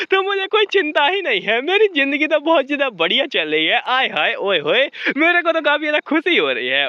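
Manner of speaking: gleeful butler, pitched high; very happy. A narrator's reading. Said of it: "अरिस्टल बहुत ज़्यादा खुश होता है". (खुशी से झूमते हुए, ऊँची आवाज़ में)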